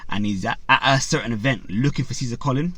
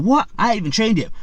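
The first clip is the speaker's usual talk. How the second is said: higher, louder, startled "what, me?"; retold surprise; 10 semitones higher, 5 dB louder than usual. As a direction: react as a boy caught off guard, mock shock. Boy told he fights tomorrow.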